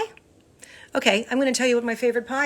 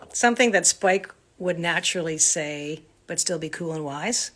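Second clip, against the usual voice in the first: ordinary delivery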